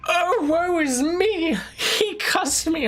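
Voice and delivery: high, slow, hammed-up whine; mock the viewer as a wounded crybaby; mocking whiny victim. Streamer mocking the viewer's sulking, whiny falsetto wail.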